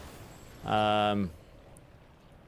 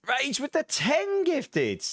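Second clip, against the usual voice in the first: much higher, amazed, slow stretched words; excited surprise; 9 semitones higher, much slower than usual. Real, not acted. To chat as Rage gifts ten subs, genuinely amazed. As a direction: gasp at the sudden gift. Reacting to a gift sub.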